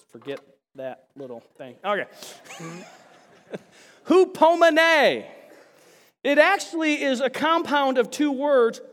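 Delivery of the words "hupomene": loud, high, drawn-out Greek word; call-out for a congregation to echo; projected with gusto. Shown as a demonstration. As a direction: belt out Hupomene slowly for a crowd to repeat. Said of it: "say it with some gusto".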